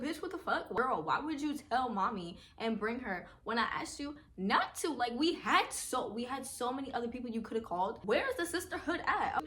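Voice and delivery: pressing her sister. Retold or imitated. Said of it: "I pressed her".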